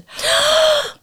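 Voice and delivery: sharp high gasp of fright; shocked gasp. Real, not acted. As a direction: gasp as if the psychic just read your mind. Scared reaction to psychic reading.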